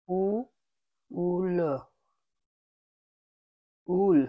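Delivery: উ then উল, drawn apart; teacher sounding out উল. Shown as a demonstration. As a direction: build উল from its vowel. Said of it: "একবার দুটা উচ্চারণ হবে".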